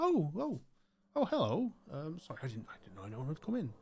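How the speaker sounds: put-on high, flustered old gentleman caught off guard; old librarian surprised by visitors; startled old man. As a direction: squeak out a surprised hello.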